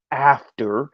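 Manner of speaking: "After" blasted out loud on its own; loud, isolated punch on "After"; emphatic AFTER. Real, not acted. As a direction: hit "After" hard and leave it hanging. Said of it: "let me say that one more time".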